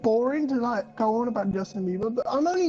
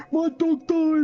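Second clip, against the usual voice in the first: mock whine; 6 semitones higher, 6 dB louder, slower than usual. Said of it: "start crying and being like".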